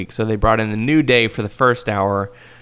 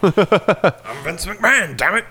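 high, slow, laughing boss voice; Vince McMahon impression; 8 semitones higher, slower than usual. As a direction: drag it out like an outraged boss. Vince staredown acted out.

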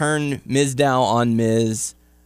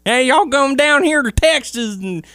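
put-on Texas accent; 11 semitones higher, 4 dB louder than usual. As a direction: play a cartoon Texan oilman. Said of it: "they're not even putting on the fucking accent".

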